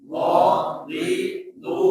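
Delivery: dha di du stretched slow and high; drawn-out syllable drill. Shown as a demonstration. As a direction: sing out Dhadidu slowly, each syllable held. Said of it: "ikuti bacaan saya setelah ini". (ucapkan Dhadidu pelan dan panjang)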